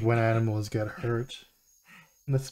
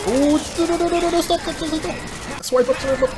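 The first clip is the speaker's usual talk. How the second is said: high frantic no no no; panicked pleading; 4 dB louder than usual. Real, not acted. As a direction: beg it to stop in panic. Panicking at game danger.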